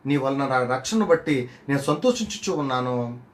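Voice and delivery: slow, solemn Bible recitation; scripture reading. Acted. Preacher reading Hannah's song to the church, slow solemn. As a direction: read the verses slowly as sacred words.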